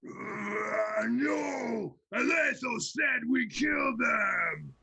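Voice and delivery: high, loud, slow brute roar; roaring ogre. Acted. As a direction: roar and seize control. Dumb head roars, takes control.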